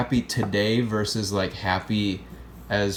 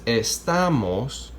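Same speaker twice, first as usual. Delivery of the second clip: "estamos" slowed, with TA stressed; second-to-last stress; much slower than usual. Shown as a demonstration. Teacher locating the accent for students, "estamos" slowly stressed on TA. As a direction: draw out the middle TA of "estamos". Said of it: "okay, second to last".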